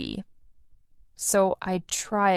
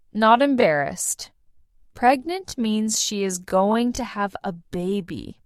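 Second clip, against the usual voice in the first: plain reading voice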